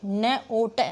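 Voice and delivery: "न ओ ट" slowly, sound by sound. Shown as a demonstration. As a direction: break it into "न", "ओ", "ट". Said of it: "ओ है वो साउंड आई वोवल की".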